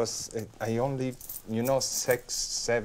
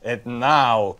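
voice of Max; 7 dB louder than usual. Shown as a demonstration. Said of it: "the voice of Max".

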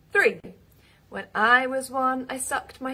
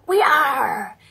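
loud pirate shout; 2 semitones higher, 8 dB louder than usual. Acted.

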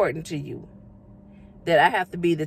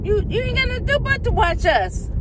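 hyped up. Real, not acted. Hyping up her fans.

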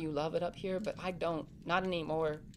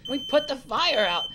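louder and higher, taking command; urgent shout; 5 semitones higher, 8 dB louder than usual. Acted. Boy takes charge of putting the fire out, urgent loud call. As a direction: bark orders over the alarm.

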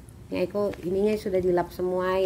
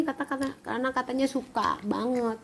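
usual speaking voice

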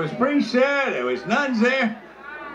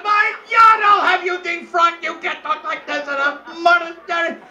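high, loud indignant tirade; mock outraged scolding; 7 semitones higher, 3 dB louder than usual. Mother Superior threatening a nun over swearing, mock outrage. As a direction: scold in a raised, high-pitched voice.

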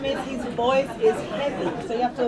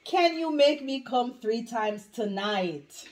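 slow and deliberate; slower than usual. Acted. Final try, spelled out slowly.

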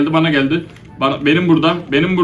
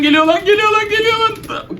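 çok tiz, telaşla bağırarak; yaratık yaklaşırken panikle haykır; panik çığlığı. Real, not acted. Yaratık geliyor, tuzak. (kovalanan oyuncunun tiz panik çığlığı)

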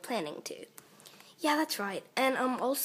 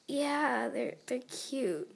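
ordinary talk, nothing marked

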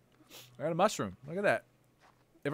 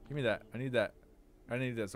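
ordinary talk

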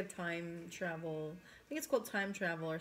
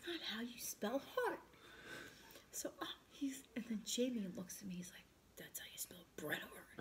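whispering; 2 semitones higher, 5 dB quieter than usual. Real, not acted. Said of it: "I have to whisper".

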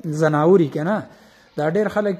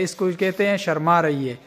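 ordinary talk

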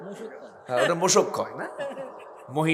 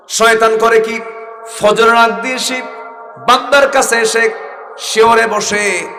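full-force preaching; 7 semitones higher, 8 dB louder than usual. Acted. Preacher voices God's call to his servant, loud and fervent. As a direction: thunder God's promise over the crowd.

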